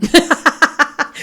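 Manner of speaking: bright open laugh, pitch jumping up; real laugh. Real, not acted. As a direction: laugh out loud at the joke. Host laughs at the remark.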